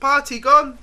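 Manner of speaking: calmly imitating the French boy. French boy answering the panicking kid, mock child voice. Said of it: "all he say to me is".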